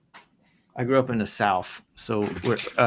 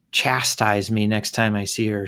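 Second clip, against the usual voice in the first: normal talking voice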